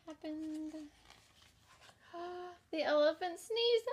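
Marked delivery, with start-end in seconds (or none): 2.1-2.6 soft gasp. Acted. Suspense gasp for children.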